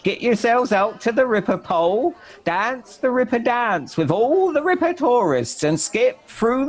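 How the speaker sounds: a wild reveller heralding the day; a high, hammy voice for the costumed general; eccentric general. Acted. General's voice in poem.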